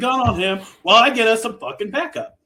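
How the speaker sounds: venting at Beth for refusing the gun; exasperated rant. Retold or imitated. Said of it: "It's like".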